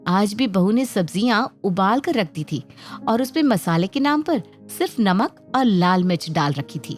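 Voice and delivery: plain reading voice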